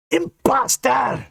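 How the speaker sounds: very high, slow ghostly shriek; shouted. Acted. Enraged ghost shrieks at Dane. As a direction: howl it with fury. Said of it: "she shouted".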